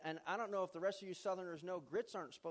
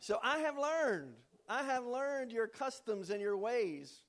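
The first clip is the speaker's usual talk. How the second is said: louder, slow, grand declaring tone; mock-solemn proclamation; 5 semitones higher, 7 dB louder, slower than usual. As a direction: announce it like a solemn vow, tongue in cheek. Mock-solemn claim after laughter.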